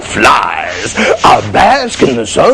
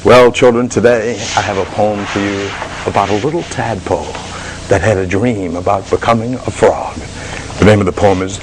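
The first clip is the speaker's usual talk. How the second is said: put-on lower, gentle reading-to-kids voice; storyteller voice; 3 semitones lower than usual. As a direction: introduce the poem to children. Host introduces poem lower.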